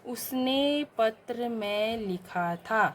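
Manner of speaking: very slow reading of the wrong sentence; slow dictation. Shown as a demonstration. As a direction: read each word slowly for pupils. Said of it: "क्या होगा वाक्य शुद्ध". (धीमा पाठ)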